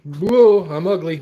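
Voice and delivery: loud, high startled whoa; surprised whoa. Real, not acted. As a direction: caught off guard by his own face. Reacts to seeing himself on camera.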